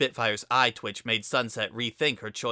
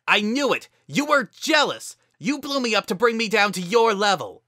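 angry shouting; 10 semitones higher, 7 dB louder than usual. A narrator's reading. Heated argument.

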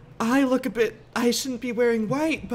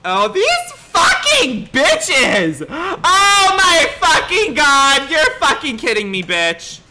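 loud, slow, drawn-out outburst; furious ranting; 3 semitones higher, 6 dB louder, slower than usual. Acted. Guest raging after being told to change, furious shouting. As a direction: rage loudly, stretching out the curses.

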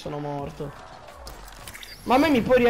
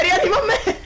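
higher, laughing cry on "me"; being chased, louder plea; 10 semitones higher, 3 dB louder than usual. Real, not acted. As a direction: beg harder, still laughing. Chased by zombies, begging revive.

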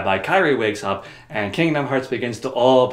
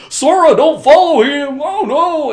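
much higher, loud, slow cartoon yelling; mock frantic call; 11 dB louder, slower than usual. Donald and Goofy calling Sora back, loud. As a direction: yell after him in cartoon panic.

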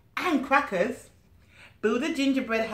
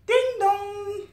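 high, loud sung ding dong; doorbell chime; 12 semitones higher, 5 dB louder than usual. Acted. Doorbell sound read aloud.

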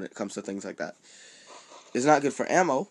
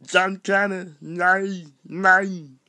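horrible impression, high and loud; badly yelled action-star accent, high-pitched; Arnold Schwarzenegger; 9 semitones higher, 6 dB louder, much slower than usual. Gamer botching a movie line, horrible high, loud impression. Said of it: "horrible Arnold Schwarzenegger expression".

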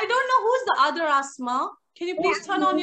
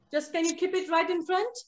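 usual speaking voice

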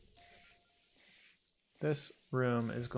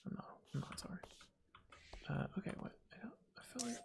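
a soft unvoiced joke; whispered aside; 8 semitones higher, 14 dB quieter, much faster than usual. Real, not acted. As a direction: whisper it like a cheeky confession. Joking aside after apology.

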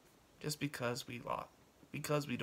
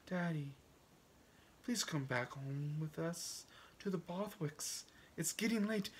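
higher-pitched daughter coaxing her father; pleading daughter; 3 semitones higher, 5 dB quieter than usual. Acted. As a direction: gently plead with Daddy to come home.

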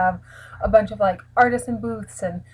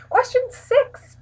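much higher, slow announcement; announcer voice; slower than usual. Acted. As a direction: announce like a game show. Announcing the next question.

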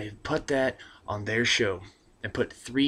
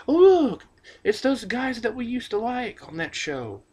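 much higher, slow, gushing fan voice; mock viewer; 13 semitones higher, 6 dB louder, slower than usual. Mocking Jersey Shore fans tuning in, high gushing voice. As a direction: squeal like an easily pleased fan. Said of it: "They might go".

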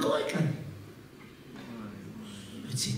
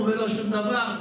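ordinary delivery

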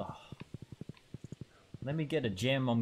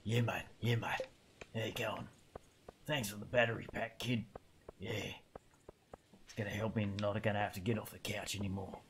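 [name] voice; 4 dB quieter than usual. Retold or imitated. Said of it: "She sounds like [name]".

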